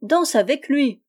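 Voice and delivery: loud, high repeated urging; insistent cry. A narrator's reading. Yann pressing Rose Eyre to dance, crying out. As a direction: call it out insistently. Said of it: "cria Yann".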